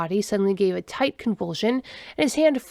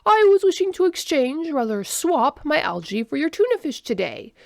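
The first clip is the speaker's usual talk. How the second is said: alien request; 7 semitones higher, 4 dB louder than usual. A narrator's reading. Alien character proposes a swap.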